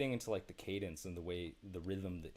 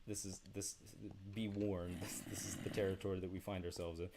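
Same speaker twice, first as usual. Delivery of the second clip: usual speaking voice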